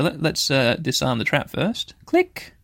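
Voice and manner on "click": squeaky click. Pretend. Clicking to disarm trap.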